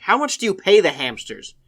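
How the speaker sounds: mock-indignant caller; imitated angry caller. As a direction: retell an indignant question. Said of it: "someone got very angry with me".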